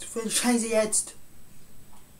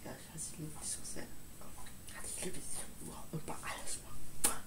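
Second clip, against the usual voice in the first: whispering; 4 semitones lower, 14 dB quieter, faster than usual. Acted. Creepy whispered love confession.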